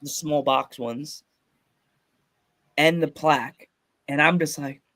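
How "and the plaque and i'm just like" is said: ordinary delivery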